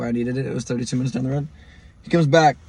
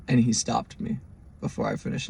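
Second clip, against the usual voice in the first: usual speaking voice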